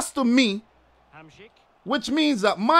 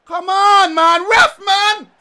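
higher, louder protest; frustrated gamer shouting; shouting at the ref; 7 semitones higher, 5 dB louder, slower than usual. Real, not acted. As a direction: yell at the ref for the foul. Angry at referee call.

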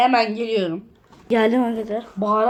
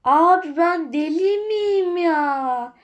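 higher, slowed, drawn-out exasperation; exasperated; 7 semitones higher, much slower than usual. Real, not acted. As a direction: wail it out, fed up with your own mistake. Frustrated with himself.

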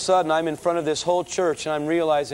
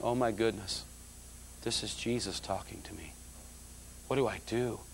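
quiet, stunned inner voice; hushed, awestruck realization; hushed awe; 6 semitones lower, 12 dB quieter than usual. Retold or imitated. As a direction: breathe it out in awe. Realizing Jesus is speaking.

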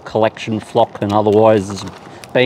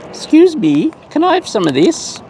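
very high, pretend bird asking politely; pleading bird voice; 13 semitones higher than usual. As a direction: squeak it high like a bird begging for seed.

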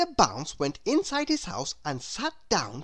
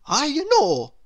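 higher, louder eureka; light up with the idea; sudden bright idea; 8 semitones higher, 6 dB louder than usual. Acted.

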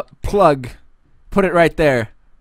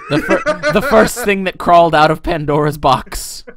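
laughing; 7 semitones higher, 7 dB louder than usual. Real, not acted. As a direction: talk through laughter. Laughing while joking.

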